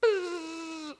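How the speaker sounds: high buzzing hum for the window going down. Retold or imitated. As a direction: mimic the power window with a buzz. Imitating the window motor.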